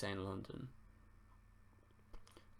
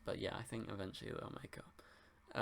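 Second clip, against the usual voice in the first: whispered; 9 dB quieter than usual. Real, not acted. Quiet guess about the show.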